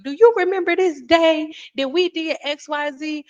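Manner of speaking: put-on high, sweet elderly auntie greeting; a fond imitation of a hundred-and-five-year-old aunt, high. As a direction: lift the voice up into a frail old auntie. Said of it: "was like".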